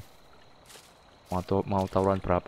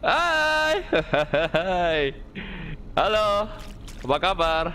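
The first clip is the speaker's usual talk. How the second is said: mock friendly greeting; 7 semitones higher, slower than usual.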